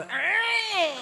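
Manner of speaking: mock high-pitched "argh"; held, high comic cry; high drawn-out squeal. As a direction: let out a long, rising-falling squeal. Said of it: "a really bad time to go".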